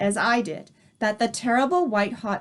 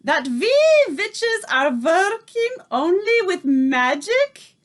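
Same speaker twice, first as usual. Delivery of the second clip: put-on higher, louder accented witch shout; 10 semitones higher, 5 dB louder than usual. A narrator's reading. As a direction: demand the answer. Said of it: "she shouted at them".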